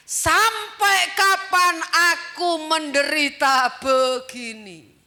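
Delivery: loud, slow preaching build; fervent preaching. Acted. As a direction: warn the church, drawn out. Preaching warning. (khotbah berapi-api, pelan)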